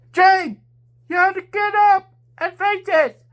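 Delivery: high, loud urging call; shout. A narrator's reading. Wind Waker shouting at fallen James to get up. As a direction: call him back to his feet. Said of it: "Wind Waker shout".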